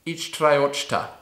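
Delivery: ordinary talk, nothing marked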